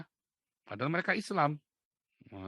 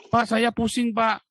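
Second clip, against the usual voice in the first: loud, high mock plea; imitated complainer; 7 semitones higher, 14 dB louder, slower than usual. Congregant telling pastor of dizziness, loud acted complaint. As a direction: act out a loud appeal to the pastor. Acts out a person seeking help.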